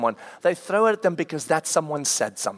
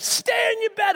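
much higher stern yell; angry command. Retold or imitated. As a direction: lay down the law. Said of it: "I'm shouting at them".

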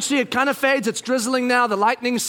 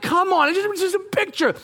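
high, whiny exasperation; exasperated pleading; 9 semitones higher than usual. Retold or imitated. Dad lining up fidgeting kids, exasperated plea. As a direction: plead as if at wit's end.

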